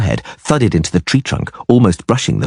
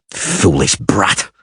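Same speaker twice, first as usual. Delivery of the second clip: hissed; 4 semitones lower, much slower than usual. A narrator's reading. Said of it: "he hissed furiously".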